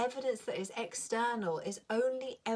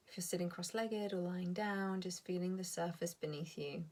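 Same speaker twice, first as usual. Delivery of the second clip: guided meditation; 5 dB quieter than usual. Acted. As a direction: ease the listeners down into calm. Leads a meditation.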